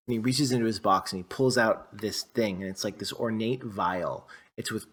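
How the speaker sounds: normal talking voice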